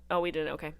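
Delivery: ordinary talk, nothing marked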